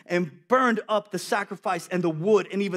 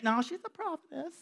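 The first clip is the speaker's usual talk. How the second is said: higher, softer gentle scold; wife voice; 8 semitones higher, 7 dB quieter than usual. Retold or imitated. Wife correcting him, higher and soft. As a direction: lightly mimic a wife's soft reproach. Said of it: "my wife".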